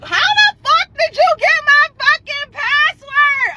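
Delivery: high, indignant outburst; retold outraged demand. Confronting the ex over her password, high retold outrage. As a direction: demand an answer at high pitch.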